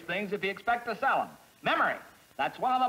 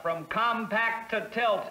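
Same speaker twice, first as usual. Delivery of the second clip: usual speaking voice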